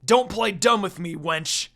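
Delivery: loud, furious demand; growled. Acted. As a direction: bark it at her. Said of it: "Inuyasha growled again".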